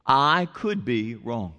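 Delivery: slow, low, measured I could be wrong; humble admission. Shown as a demonstration. Pastor modelling a phrase, slow humble admission. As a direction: say it slowly and humbly. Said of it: "Here's the thing to say".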